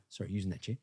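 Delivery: lightly voiced laid-back Australian, soft and quick; casual Australian ask. A hint of an Australian asking a stranger for a chair, easy and brief. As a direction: toss it off like a relaxed local. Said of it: "We go".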